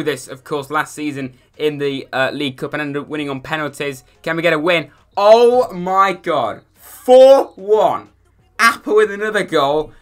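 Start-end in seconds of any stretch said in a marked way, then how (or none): 5.2-9.9 slow, loud, high amazement; thrilled by the score; excited. Real, not acted. Big win result.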